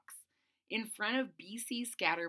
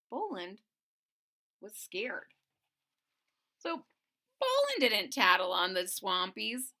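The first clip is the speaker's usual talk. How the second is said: ordinary delivery, nothing marked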